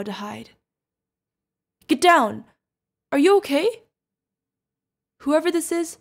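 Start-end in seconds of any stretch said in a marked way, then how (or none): 1.9-3.8 loud warning shout, pitch jumping high; urgent alarm. Acted. Sudden attack, shielding the companion. 5.2-6.0 loud, raised call to a hidden enemy; angry challenge. Acted.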